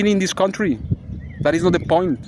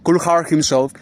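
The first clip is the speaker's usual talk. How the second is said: ordinary talk, nothing marked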